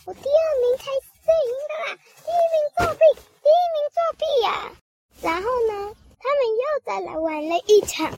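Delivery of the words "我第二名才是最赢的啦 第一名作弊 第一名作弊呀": high, fast, whining accusations; sore loser whine. Acted. Squirrel loses and accuses winner of cheating, high fast whine. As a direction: cry foul like a bratty kid. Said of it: "他又说".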